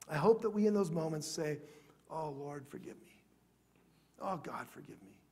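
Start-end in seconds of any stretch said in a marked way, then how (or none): 2.1-5.2 hushed, low plea to God; very soft, contrite praying; penitent prayer. Acted. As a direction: murmur it softly as a humble confession.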